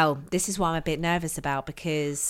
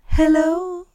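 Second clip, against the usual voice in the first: cheery hello; 9 semitones higher, 7 dB louder than usual. Shown as a demonstration. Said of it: "or just say".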